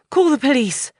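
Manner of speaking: urgent high breathless call; out of breath after pinning Martin down. A narrator's reading. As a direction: gasp it out after a struggle. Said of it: "she says breathlessly".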